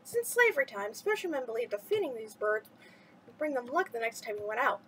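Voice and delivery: usual speaking voice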